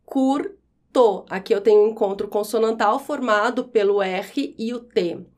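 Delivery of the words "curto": "Curto" split into cur, to; syllable by syllable. Shown as a demonstration. Teacher separating R from T, syllable by syllable. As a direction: pause after the R in "Curto". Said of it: "O mesmo acontece em curto".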